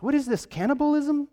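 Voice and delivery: mock shock. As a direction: pretend to be scandalised. Mock outrage at strange teaching.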